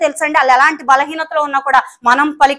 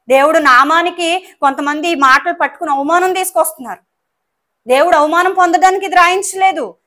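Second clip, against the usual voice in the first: raised, higher preaching voice, pressing the point; fervent preaching; 4 semitones higher, 4 dB louder than usual. Acted. Pastor rebuking misuse of scripture, fervent raised preaching. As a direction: preach it out loud to the congregation.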